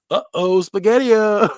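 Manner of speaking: sing-song corny dad joke. Pretend. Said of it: "that corny dad".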